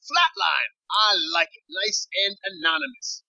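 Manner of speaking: high-pitched woman on a call; intense, high female character voice. Acted. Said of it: "intense female voice".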